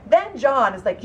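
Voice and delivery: ordinary delivery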